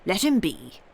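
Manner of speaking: a lightly voiced dignified general; a hint of an old general's dignity. A narrator's reading. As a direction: settle it with calm authority. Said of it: "with a dignified air".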